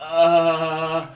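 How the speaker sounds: loud mock groan of aching effort; drawn-out, hammed-up "uh"; sore groan. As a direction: groan like you can't get out of bed. Too sore to get up.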